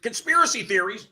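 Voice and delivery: high, incredulous echo; losing it. Retold or imitated. To the person hiring him, retold incredulous outrage. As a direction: throw it back in retold disbelief. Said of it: "And I lost it".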